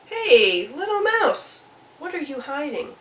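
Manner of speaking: high, swooping sing-song call; curious Cardinal. Acted. Cardinal asks Mouse what is hidden, high sing-song. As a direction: hail Mouse brightly, then pry.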